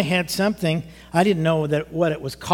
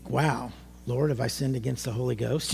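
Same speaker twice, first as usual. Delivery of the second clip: scared; 5 semitones lower, 7 dB quieter than usual. Retold or imitated. Afraid he sinned.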